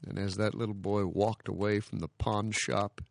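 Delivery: usual speaking voice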